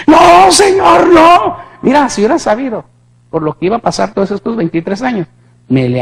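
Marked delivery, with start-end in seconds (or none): 0.1-1.6 high, slow, loud desperate cry; anguished begging, much higher; pleading shout. Retold or imitated. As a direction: cry out to keep him from going. Begging the Lord to stay.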